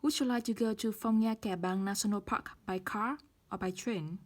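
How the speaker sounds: car rises, train falls; rise then fall choice. Shown as a demonstration. Teacher models the choice question with rise then fall. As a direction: lift on car, drop on by train. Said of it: "rising intonation and falling intonation".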